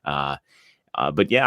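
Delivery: ordinary talk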